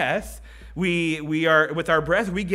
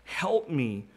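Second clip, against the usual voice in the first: understated, subdued plea to God; a quiet, falling appeal for help; pleading cry; 2 semitones lower, 4 dB quieter than usual. Acted. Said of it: "Have you ever cried out to God like that?".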